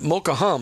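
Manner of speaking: "Hum" said with an audible H; "Hum" begun with a puff of H; H sounded. Shown as a demonstration. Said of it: "the H is not pronounced".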